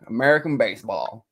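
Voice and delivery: "American baseball" in a put-on American accent; lightly exaggerated American accent. Shown as a demonstration. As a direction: show off the mock-American sound. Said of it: "And then you get it".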